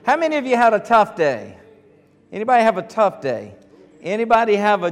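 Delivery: loud, high and lively greeting; rousing preaching. Acted. Pastor warming up the congregation, loud rousing preaching. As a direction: work up the crowd with energy.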